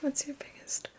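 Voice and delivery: barely audible whisper to self; trying the words under the breath; whispered rehearsal. Real, not acted. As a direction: whisper it as a tentative practice.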